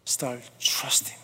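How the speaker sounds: slow unvoiced whisper. Shown as a demonstration. Preacher closing his sermon in a slow whisper. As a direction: let it fall to a hush, very slow. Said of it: "closing refrain".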